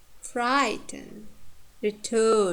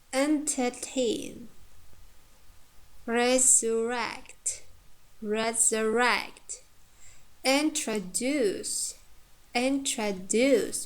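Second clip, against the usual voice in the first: slow demo, stressing "tain" in entertain, "rect" in resurrect, "duce" in introduce; teacher's slow "entertain, resurrect, introduce", final syllables stressed; stress on last syllable; 2 semitones higher, slower than usual. Shown as a demonstration. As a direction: draw out each word, weight on the end of "entertain", "resurrect", "introduce". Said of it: "last syllable is stressed".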